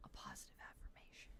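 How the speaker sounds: soft, fast whisper; hushed whispered compliment; whispered affirmation. Acted. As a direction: breathe the words like a secret.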